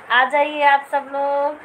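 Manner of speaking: slow, drawn-out loud call. Real, not acted. Parent calling everyone to come over, calling out. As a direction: stretch the words out to summon everyone.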